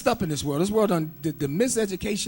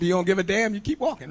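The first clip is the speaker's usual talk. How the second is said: ordinary delivery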